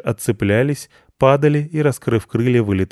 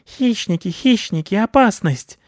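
panicked bats; 13 semitones higher, 3 dB louder than usual. A narrator's reading.

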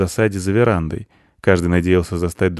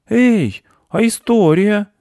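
put-on high, loud kid's protest; indignant boy; 13 semitones higher, 6 dB louder, slower than usual. A narrator's reading. Boy demands the promised story, indignant. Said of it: "возмутился он".

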